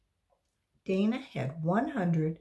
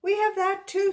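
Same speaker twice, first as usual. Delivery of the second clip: teacher; 14 semitones higher, 7 dB louder than usual. Acted. Teacher voice, high.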